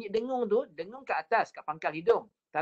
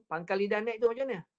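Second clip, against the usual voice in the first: ordinary talk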